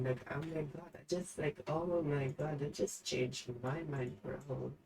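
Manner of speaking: ranting under her breath; trying to calm down. Real, not acted. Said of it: "I gotta calm down for a second".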